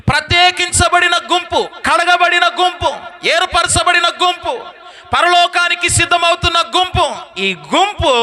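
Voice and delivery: rising, shouted sermon list; fervent preaching. Acted. (ఉత్సాహంగా గట్టిగా ప్రసంగం)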